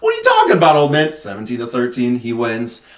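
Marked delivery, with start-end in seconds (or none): 0.0-1.2 very high, loud mock disbelief; scoffing young bully. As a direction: squawk it at an old man.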